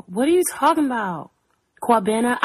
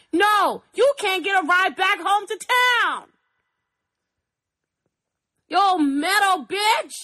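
mock rant; 9 semitones higher than usual. Ranting at the meadow girl.

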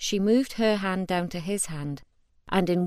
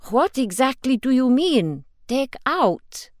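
shocked question; 6 semitones higher, 8 dB louder than usual. Acted. Felipe, shocked, asks Ashol.

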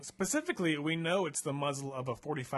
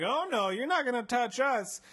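high, louder protest; defiant fear; 9 semitones higher, 5 dB louder than usual. Retold or imitated. Kidnapped child refusing the doctor, high defiant protest. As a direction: refuse him in high alarm. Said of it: "we're like".

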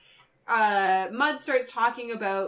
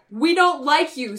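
Kirk voice; 4 semitones higher, 7 dB louder than usual. Retold or imitated. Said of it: "says Kirk".

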